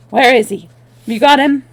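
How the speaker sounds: loud urgent demand. Acted. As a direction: bark the question. Conrad bursting out angrily.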